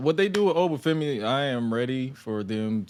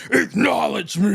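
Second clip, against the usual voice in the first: louder, much higher Roman shout; commanding shout; 8 semitones higher, 6 dB louder, slower than usual. Retold or imitated. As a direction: demand it like the top dog. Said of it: "you have Roman say".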